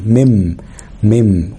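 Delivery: مم with a lingering final m; noon turned meem. Shown as a demonstration. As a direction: say مم ending on a hummed m. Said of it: "قلبت النون لميم".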